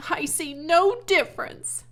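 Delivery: high, loud retort; girl defying her father loudly; insistent reply. A narrator's reading. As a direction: refuse to give in. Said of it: "replied Fern".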